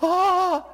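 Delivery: put-on very high, loud baby "ah"; squealed baby "ah" sound; baby feeding noise. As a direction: mimic a baby opening for milk. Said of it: "They, they sit there and go".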